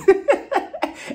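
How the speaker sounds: a high, bright burst of real laughter. Real, not acted. Recapping the show to viewers, real high laugh. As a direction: laugh before setting up the fight. Laughs before the fight setup.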